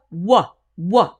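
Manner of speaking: "wa" said loud and firm; solid wa. Shown as a demonstration. Coach shows the W said alone. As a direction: bark "wa" crisply. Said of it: "When I say this word by itself".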